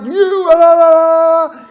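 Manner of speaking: loud, high mock babble; hammed-up childish yelling at the phone; mocking shout. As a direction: drown her out with a loud la la la. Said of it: "And he's like".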